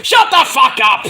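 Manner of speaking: telling a wrestler off with a loud, high shout; mock shout. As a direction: bark it at the annoying guy. Mocking Disco Inferno.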